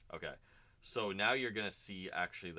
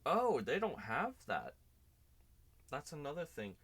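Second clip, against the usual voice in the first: surprised; 10 semitones higher, 7 dB louder than usual. Real, not acted. Surprised by a missing feature.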